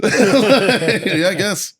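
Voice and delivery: high laughing shrug; laughing disbelief at the colonel. Real, not acted.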